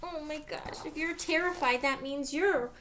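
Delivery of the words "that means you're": usual speaking voice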